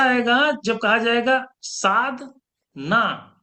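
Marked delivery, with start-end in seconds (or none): 1.6-3.3 साध, a clear pause, then ना; gap in the word. Shown as a demonstration. Guru showing students the hidden meaning, pause between साध and ना. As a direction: break Sadhana in two with a held gap before ना. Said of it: "gap आ गया".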